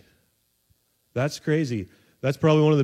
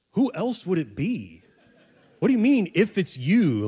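higher, mock-exasperated questioning; hammed-up incredulity, pitch swooping; incredulous; 5 semitones higher than usual. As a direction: act out disbelief at a silly question. Preacher mocks Peter's doubting question.

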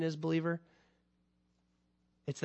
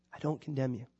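tender Lord's words; 4 semitones lower, 7 dB quieter than usual. Acted.

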